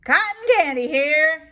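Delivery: loud, quick vendor call; vendor's yell. Acted. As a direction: hawk cotton candy over the crowd. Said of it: "yells the boy".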